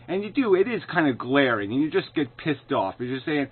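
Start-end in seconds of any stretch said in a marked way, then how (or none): none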